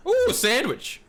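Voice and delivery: thrilled at finding food; excited. Real, not acted. Excited at spotting sandwich.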